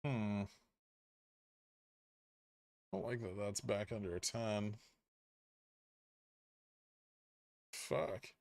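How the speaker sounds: soft, muttered disappointment; under the breath, let down; quiet dismay. Real, not acted. Dismayed at chart.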